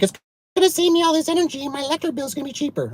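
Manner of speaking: very high, fast mock customer chatter; hammed-up high-pitched customer; imitating customer. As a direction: rattle it off in a silly high customer voice. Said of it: "And they're like".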